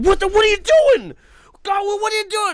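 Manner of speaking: very high, loud mock panic; acted shocked outcry. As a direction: act out a horrified squawk of protest. Retold shock at being peed on.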